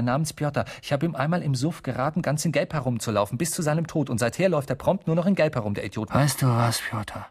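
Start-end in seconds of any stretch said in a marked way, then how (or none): none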